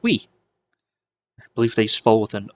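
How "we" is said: French "oui". Shown as a demonstration. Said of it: "if you're French".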